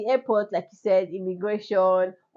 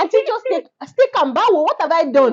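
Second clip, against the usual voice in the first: higher, louder flustered cry; panicked pleading; 8 semitones higher, 5 dB louder than usual. Retold or imitated. Traveller panicking at airport search, flustered high pleading.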